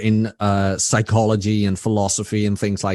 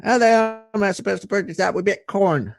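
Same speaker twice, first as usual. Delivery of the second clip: high-pitched American guy impression; Rob impression; 9 semitones higher than usual. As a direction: put on a much higher voice, mimicking an American. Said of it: "the best impression I can make".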